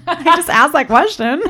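high giggle breaking through the words; laughing at herself. Real, not acted. Wife laughing at her own silly question. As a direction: laugh it off, amused at yourself.

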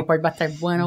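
ordinary delivery

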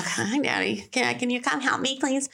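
put-on high, wheedling little-girl plea. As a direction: beg Daddy for help like a little girl. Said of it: "try to do the voice".

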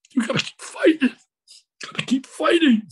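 high-pitched, insistent retold plea; pleading encouragement, pitched high; urging on. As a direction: urge a friend to keep fighting. Retold urging of dying friend.

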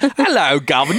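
mock Cockney "Hello, governor"; awful fake Cockney accent for laughs. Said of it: "my Cockney accent".

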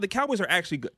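usual speaking voice

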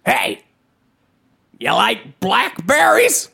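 slow creepy stranger voice; creepy blackberries guy. Pretend. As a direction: lure them in, slow and creepy. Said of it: "you sound a lot like McLeach right now".

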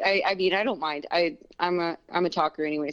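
ordinary delivery

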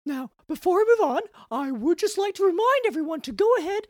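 high, chirpy koala mascot voice; cartoon mascot host. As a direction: play a cheesy corporate mascot host. Mascot character hosting.

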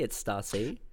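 "Stacy" said softly as STAH-see; corrected name pronunciation. Shown as a demonstration. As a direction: say "Stacy" with a long AH, like STAR-see. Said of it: "when people are like".

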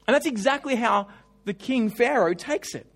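ordinary delivery

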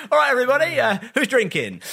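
high, loud rallying call; mock cheery shout. Calling out to an audience straight after a grim film, mock cheery. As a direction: hail the whole room like a party starter.

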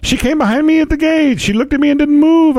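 silly high-pitched mock Irish; hammed-up Irish. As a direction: overdo the Irish accent in a high voice. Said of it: "I'm Irish".

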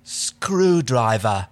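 very slow, drawn-out word; flat. Acted. Erin answers John's silly question, flatly and very slowly. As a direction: stretch it out with no warmth. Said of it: "she said flatly".

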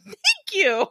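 lifted, pleased little thank-you; high mock thanks. Mom thanking her family for compliments, high and gushing. Mom's pleased reply, quoted.

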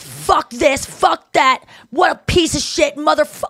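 mock the fired-up cursing; replaying an angry rant, cursing. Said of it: "so angry".

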